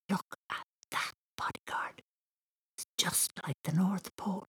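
soft breathy whisper. A narrator's reading. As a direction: hush it, full of wonder. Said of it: "Thomas whispered".

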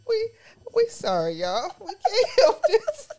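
high, slow, squeezed out through laughter; laughing apology. Real, not acted. Apologizing to the listeners mid laughing fit. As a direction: barely get it out while cracking up.

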